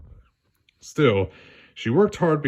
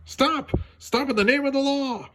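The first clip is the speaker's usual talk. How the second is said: high put-on voice, calling out; Judy yelling; 14 semitones higher than usual. Judy yelling at the fleeing thief in high voice. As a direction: shout after the thief like a rookie cop. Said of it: "yelled at him".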